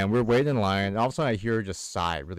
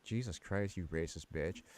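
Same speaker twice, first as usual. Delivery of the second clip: softer exasperated aside; muttered under breath; 8 dB quieter than usual. Retold or imitated. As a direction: grumble it to yourself. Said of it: "I'm just like".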